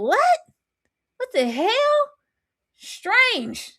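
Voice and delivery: bewildered high-pitched protest; incredulous. Real, not acted. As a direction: go way up, stunned. Speaker's incredulous reaction.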